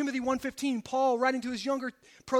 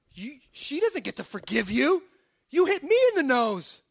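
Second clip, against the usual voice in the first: indignant protest; 3 semitones higher, 5 dB louder than usual. Acted. Punched man protesting the wife's forgiveness, indignant. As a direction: object as the wronged party.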